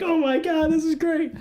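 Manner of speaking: way up high, thrilled and giggly; high giddy delight. Retold or imitated. Teen mocking funny names in files, high gleeful squeal. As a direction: gush like you found treasure.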